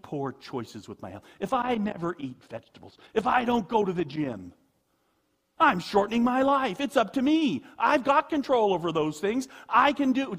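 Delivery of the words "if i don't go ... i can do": loud, higher, cocky insistence that it is all up to him; self-reliant boast. Pretend. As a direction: play the proud man who thinks he controls his life, louder and higher. Voicing a self-reliant worrier.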